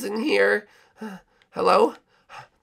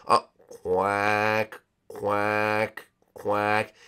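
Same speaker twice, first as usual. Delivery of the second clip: low slow dreamy quacks; dazed penguin quack; 11 semitones lower, 4 dB quieter, much slower than usual. Acted. Hypnotised penguin answering queen, low quacks. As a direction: quack as if entranced.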